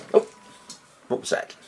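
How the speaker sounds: normal talking voice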